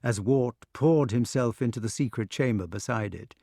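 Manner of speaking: ordinary delivery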